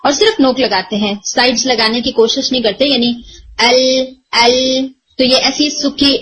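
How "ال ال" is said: ال said with only the tongue tip, squeezed and stretched. Shown as a demonstration. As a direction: press just the tip for ال, a tight narrow sound. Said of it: "ایسی اسکویزڈ sound آتی ہے". (ال کو نوک سے دبا کر، گھٹی گھٹی آواز میں)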